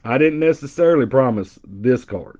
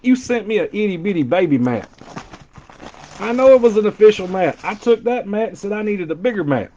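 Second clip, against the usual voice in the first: mock complaint; 6 semitones higher than usual. Mock complaint about small mat.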